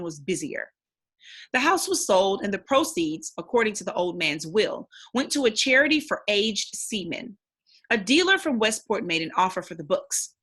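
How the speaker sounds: steady, expressive read-aloud narration; story narration. Acted. Teacher reading the novel to the class, storytelling narration. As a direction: read the chapter aloud to young listeners.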